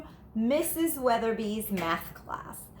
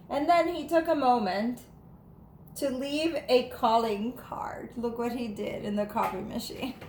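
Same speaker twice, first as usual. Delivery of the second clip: plain reading voice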